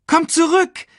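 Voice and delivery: high, loud meerkat call. Acted. Meerkat calling the cub back, high shout. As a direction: holler after him in a high voice. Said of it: "rief er Simba zu".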